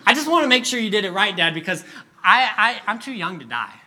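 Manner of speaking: put-on high, loud, stammering kid; hammed-up boyish panic; panicked little boy. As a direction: plead with Dad for your life. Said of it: "panicked the whole night".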